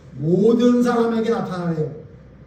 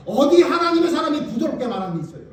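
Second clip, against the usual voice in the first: ordinary delivery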